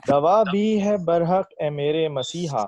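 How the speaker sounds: slow, measured verse delivery; ghazal recitation. Acted. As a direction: recite it like a poet at a mushaira. Recites own ghazal at mushaira.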